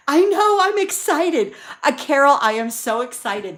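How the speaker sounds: high, loud burst of real excitement; bubbling over with real excitement, squealing it out; excited. Real, not acted. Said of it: "you can hear the excitement in my voice".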